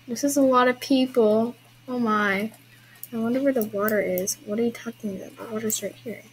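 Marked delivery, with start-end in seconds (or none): none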